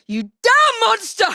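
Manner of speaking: high, slow furious yell; furious shout. Acted. Evie curses the monster in a furious shout.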